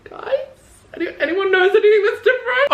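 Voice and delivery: high sing-song fishing for compliments; squeaky innocent ask; high fake-innocent voice. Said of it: "I'll be like".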